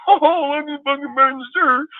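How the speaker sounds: very high squeaky mocking voice; mocking whiny fan. Streamer mocking a fickle fan in a high squeal. Mocking fans who burn jerseys.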